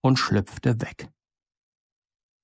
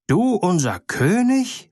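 higher, slow indignant outcry; angry birds; 6 semitones higher, slower than usual. A narrator's reading. The birds confront the little bird, indignant and higher. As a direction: scoff at the little cheat. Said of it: "schrien die Vögel zornig".

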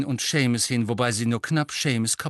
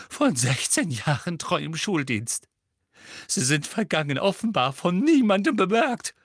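sobbing; 7 semitones higher, 3 dB louder than usual. Acted. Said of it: "schluchzte sie".

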